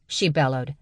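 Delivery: ordinary delivery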